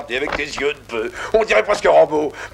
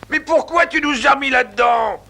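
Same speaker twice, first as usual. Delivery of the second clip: high-pitched, raised-voice reproach; yelling complaint; 6 semitones higher than usual. Acted. Prisoner blaming his cellmate for landing them inside, frustrated yelling. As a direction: yell at your cellmate in frustration. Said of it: "Arrête de gueuler".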